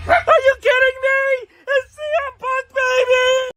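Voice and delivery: very high, loud hype yell; hype shout. Retold or imitated. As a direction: scream it like a crazed fan. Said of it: "I gotta do this".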